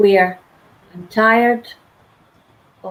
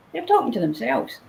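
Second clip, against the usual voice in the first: ordinary delivery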